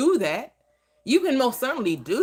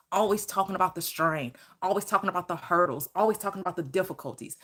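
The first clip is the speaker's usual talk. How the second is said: ordinary talk, nothing marked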